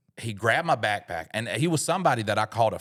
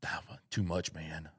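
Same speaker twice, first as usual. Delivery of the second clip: quiet; 4 semitones lower, 10 dB quieter than usual. Retold or imitated. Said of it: "everybody's just like".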